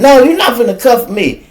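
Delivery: high, loud retold refusal; mock yell. Woman refusing to be cuffed by the officer, loud high mock yell.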